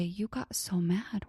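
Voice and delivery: quiet whisper, slow and gentle; soft whisper. Shown as a demonstration. Parent calmly naming an angry child's feeling, whispered slowly. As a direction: let it out slowly on breath.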